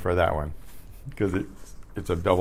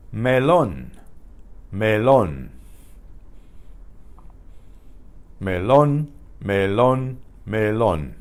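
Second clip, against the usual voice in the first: melon said me-LON, Spanish style; stress on O; slower than usual. Shown as a demonstration. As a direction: stress the last syllable of melon. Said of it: "there is an accent on the O".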